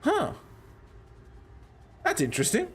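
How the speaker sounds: puzzled. Retold or imitated. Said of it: "I stand up going".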